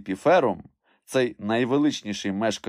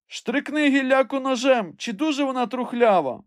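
very high, raised voice; calling orders; 4 dB louder than usual. A narrator's reading. Master tells climber to test the branch, high call. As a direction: shout the instruction upward. Legrand calls instructions up.

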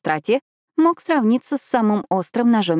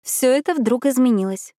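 ordinary delivery

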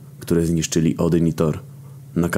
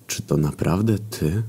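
sad; 4 dB quieter than usual. A narrator's reading. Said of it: "spytałem smutno".

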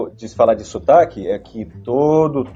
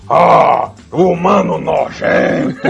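slow, drawn-out villain sneer; heavy villain voice; 4 semitones higher, 5 dB louder, much slower than usual. Retold or imitated. Retelling the actor's dubbing take, slow and heavy. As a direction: stretch out the disgust like a robot villain. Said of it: "Fez bem, bem puxadão assim".

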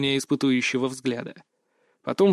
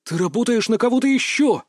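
higher-pitched sudden realisation; blurted out; 7 semitones higher than usual. A narrator's reading. Captive accuses his kidnapper of another employer, blurting it out. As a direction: blurt it out as it dawns on you. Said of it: "выпалил я".

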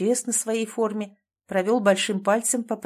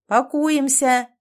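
loud, raised, stretched-out command; barked order; 5 semitones higher, 6 dB louder, much slower than usual. A narrator's reading. Commander rallying the crew by the vehicle, barking. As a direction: call it out across the yard. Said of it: "рявкнул возле машины Захар".

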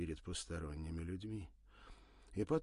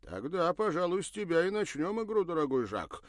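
snide; 10 semitones higher, 10 dB louder than usual. Acted. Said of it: "ехидно сказал Бернар".

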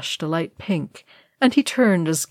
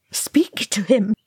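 half-voiced, higher plea; pleading; 4 semitones higher than usual. A narrator's reading. Oin pleading with Thorin for Gimli, pleading. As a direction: beg in a raised, strained character voice. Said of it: "Oin pleaded".

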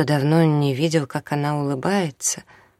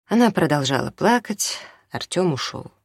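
usual speaking voice

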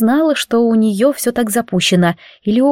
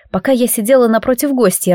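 ordinary delivery

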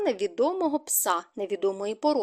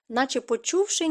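ordinary delivery

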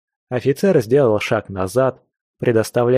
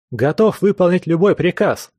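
shouted soldier reply; 6 semitones higher than usual. A narrator's reading. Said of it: "выкрикнуть, подражая заправскому военному".

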